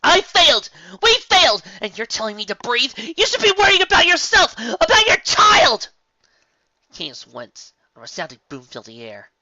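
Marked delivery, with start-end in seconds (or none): 0.0-5.9 much higher, loud tearful scream; raging scream. A narrator's reading. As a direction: Twilight unleashes her pent-up rage. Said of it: "unleashing all her pent-up rage".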